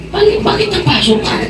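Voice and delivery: high, surprised voice of the wife; wife asking. Retold or imitated. Wife asking her husband why he is burned. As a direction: ask as if puzzled by his pain. Wife's reply in retold exorcism.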